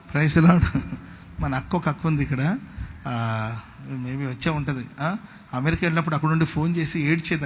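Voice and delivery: ordinary talk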